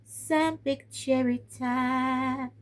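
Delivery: high, slow, stretched-out words; slow sing-song build-up; drawn-out tease. Acted. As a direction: draw each word out like a game-show reveal. Playful drawn-out announcement.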